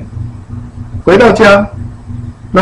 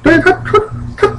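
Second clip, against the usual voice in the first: normal talking voice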